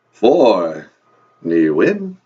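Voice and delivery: low, loud vampire count. Acted. Count says the English numbers for the host, booming vampire voice. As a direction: announce the number like a theatrical vampire.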